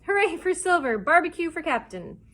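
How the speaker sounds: high, loud jubilant hurrah; shouted cheer. A narrator's reading. Pirates hailing their captain, shouting. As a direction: cheer like a hooting crew. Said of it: "laughed and hooted and shouted".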